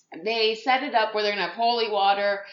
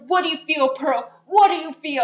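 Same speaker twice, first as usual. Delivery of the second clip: higher, loud pressing demand; hammed-up confrontation up close; mock brooding vampire grilling Pearl; intense boyfriend; 7 semitones higher, 7 dB louder than usual. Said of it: "in her face".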